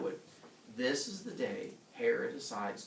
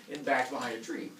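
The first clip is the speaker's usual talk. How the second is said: usual speaking voice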